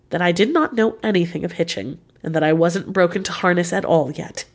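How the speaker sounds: ordinary delivery, nothing marked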